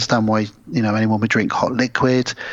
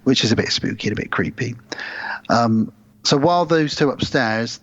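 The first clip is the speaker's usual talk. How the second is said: ordinary delivery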